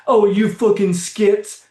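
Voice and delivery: mock a classmate the way kids do; kid taunt. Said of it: "we'd be like".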